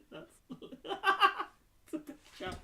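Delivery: laughing through the words; breaking into high giggles. Real, not acted. Laughing at a diagram on Twitter.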